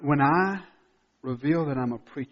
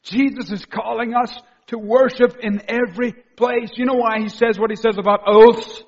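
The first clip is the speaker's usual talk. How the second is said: loud, high-pitched, driving sermon delivery; full-force preaching; 7 semitones higher, 8 dB louder than usual. Real, not acted. Preacher urging the congregation not to miss this, full force. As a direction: preach it loudly with raised pitch.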